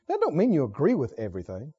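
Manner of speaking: ordinary talk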